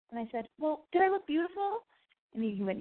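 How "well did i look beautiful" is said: acted hopeful question. Acts out fishing for a compliment.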